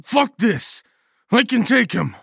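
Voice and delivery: loud, high-pitched angry shout; defiant snarl. A narrator's reading. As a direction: snarl it, spoiling for a fight. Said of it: "I snarled".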